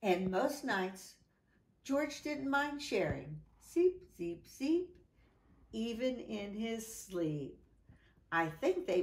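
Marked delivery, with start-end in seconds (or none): 3.6-5.0 snore noise. Acted. Dog snoring sound effect.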